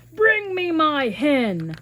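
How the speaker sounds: slow, loud giant order; giant's command. Acted. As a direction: demand it like a lazy giant. Giant orders his wife.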